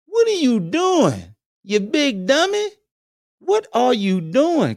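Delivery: high, slow disbelieving scold; exasperated scolding. Acted. Scolding Paul Pierce in high slow disbelief. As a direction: drag it out like you can't believe him.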